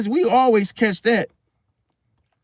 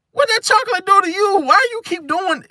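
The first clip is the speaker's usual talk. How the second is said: high incredulous; 11 semitones higher than usual. Retold or imitated. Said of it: "I'm like".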